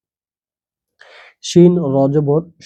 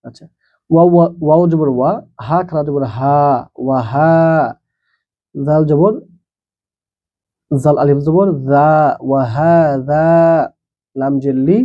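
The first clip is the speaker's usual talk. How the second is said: ordinary talk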